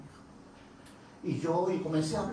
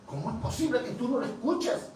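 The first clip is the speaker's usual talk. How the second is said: incredulous question; 7 semitones higher, 3 dB louder than usual. Retold or imitated. Preacher astonished.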